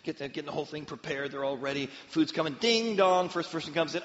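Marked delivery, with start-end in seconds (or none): 2.6-3.3 high, loud sung ding dong; doorbell imitation. Preacher imitating the doorbell, high and loud sing-song. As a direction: chime it like a doorbell.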